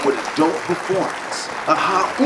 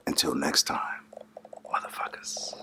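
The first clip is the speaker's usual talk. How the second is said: whisper; 13 dB quieter than usual. Pretend. Whispered sign-off to close show.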